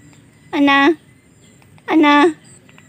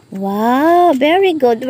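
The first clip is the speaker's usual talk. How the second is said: ordinary delivery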